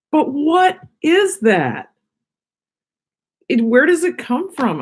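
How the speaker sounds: incredulous. Real, not acted. Puzzled about mukbangs.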